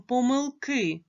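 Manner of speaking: slow "помилки" with the final "ки" stressed; last-syllable stress. Shown as a demonstration. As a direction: stretch the stressed "ки" ending "помилки". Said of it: "падає на останній склад". (повільно "помилки", наголос на останній склад "ки")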